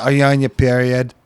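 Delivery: put-on weak Boston accent, barely there; bad Boston accent. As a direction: try a Boston accent and miss. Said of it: "That was a bad Boston accent".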